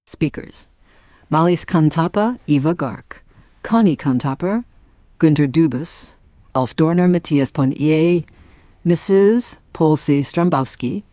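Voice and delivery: loud, clear radio announcer read; credits announcement. Acted. Announcer reads the cast list to listeners.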